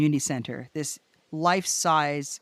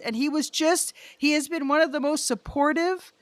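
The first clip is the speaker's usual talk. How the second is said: ordinary delivery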